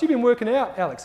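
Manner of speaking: a colleague teasing Alex as she walks past; mock flirty. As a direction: toss a cheeky flirt over your shoulder. Alison flirts in passing.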